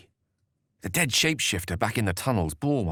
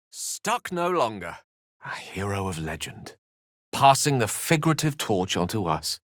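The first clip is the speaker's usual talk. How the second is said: normal talking voice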